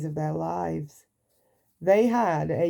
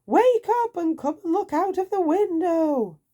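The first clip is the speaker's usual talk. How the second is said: high, loud, eager call; Mister Silly urging his friend to look; excited; 12 semitones higher, 4 dB louder than usual. Acted. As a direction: hurry him to the window. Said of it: "cried Mister Silly".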